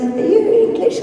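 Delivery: high, surprised question; asking it high and curious; questioning stranger. Retold or imitated. Pub stranger asking if she is English, high. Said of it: "someone goes".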